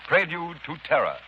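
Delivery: normal talking voice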